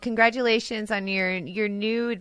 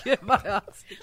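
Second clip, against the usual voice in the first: laughing Vader joke; faster than usual. Pretend. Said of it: "Vader joke repeated".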